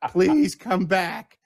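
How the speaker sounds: high, drawn-out mock plea; pleading dog call. Dog owner begging a lost pet to return, high pleading call. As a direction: beg the runaway dog, slowly and high. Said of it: "you sound like an idiot".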